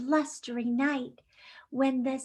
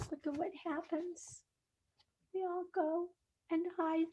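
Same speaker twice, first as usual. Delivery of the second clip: softer, quick hushed aside; 2 semitones higher, 13 dB quieter, faster than usual. Acted. Teacher whispering to kids as animals hide, softer. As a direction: hush like everyone's hiding. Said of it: "hushed to children".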